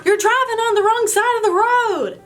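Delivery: a stranger shouting through the window; mock yelling lady. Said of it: "yelling at us".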